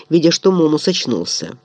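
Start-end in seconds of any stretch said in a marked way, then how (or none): none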